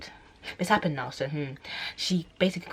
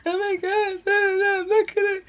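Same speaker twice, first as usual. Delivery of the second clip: high, loud sobbing panic; crying; 7 dB louder than usual. Retold or imitated. Breaking down on Tasha, high loud sob. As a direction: wail it through tears. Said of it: "So I'm crying like".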